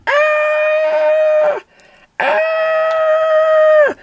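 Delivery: put-on very high, loud girlish scream. A narrator's reading. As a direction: shriek in fright like a girl. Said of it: "she screamed".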